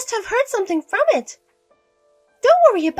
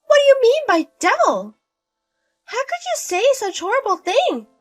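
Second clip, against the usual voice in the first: indignant; 12 semitones higher, 5 dB louder than usual. Acted. Mother-in-law angry at son.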